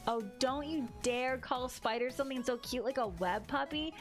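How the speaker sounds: ordinary delivery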